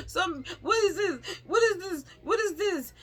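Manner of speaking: high, panicky repeated question; freaked out. Retold or imitated. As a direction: pester your husband in rising alarm. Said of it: "I just freaked out".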